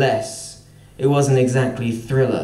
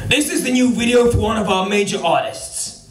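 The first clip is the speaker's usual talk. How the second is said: put-on higher American boss accent, tightly held; American executive accent; 6 semitones higher than usual. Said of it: "This is where I have to do the accent".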